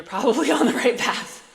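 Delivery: real laugh breaking into the sentence; amused, laughter bubbling into the line; laughing through the words. Real, not acted. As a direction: crack up while saying it. Amused by own crazy-path remark.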